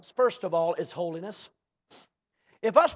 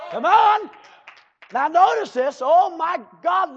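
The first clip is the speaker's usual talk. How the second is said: ordinary talk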